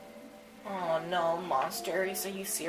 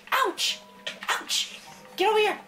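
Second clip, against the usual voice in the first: pained yelps; 12 semitones higher, 7 dB louder than usual. Acted. Being hit by mob.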